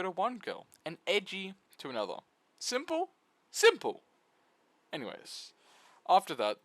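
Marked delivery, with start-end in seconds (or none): 2.6-3.1 high, rising question, teasing; mock question. Mock question answered by herself. 3.5-4.0 high and loud, triumphant; emphatic answer. Pretend. Answers own mock question loudly.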